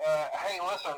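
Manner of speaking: quiet, hesitant phone message, trailing into pauses; uneasy, halting. Acted. Night guard leaving a last message on a bad night, uneasy. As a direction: hold back fear and keep it casual, softly.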